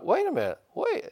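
sudden realization. Retold or imitated.

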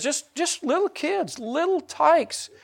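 very high, slow, drawn-out words; tiny high voice. Real, not acted. Preacher pleads about kids being told things, tiny high voice. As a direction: shrink the voice to show how little they are. Stressing how small the children are.

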